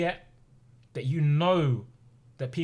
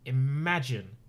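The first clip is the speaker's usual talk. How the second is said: ordinary talk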